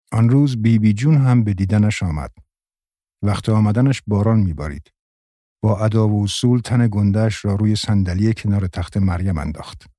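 ordinary delivery, nothing marked

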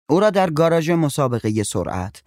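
usual speaking voice

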